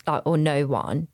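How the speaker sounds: normal talking voice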